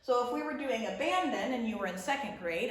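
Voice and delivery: usual speaking voice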